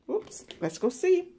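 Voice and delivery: usual speaking voice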